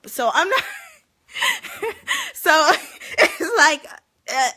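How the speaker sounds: high, loud burst of laughter; real laughter. Real, not acted. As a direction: crack up helplessly. Laughing about the bonnet.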